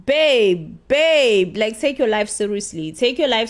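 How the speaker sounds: BABE, BABE, each loud and falling; exasperated scolding. Real, not acted. Vlogger telling Zethu to get serious, exasperated scolding.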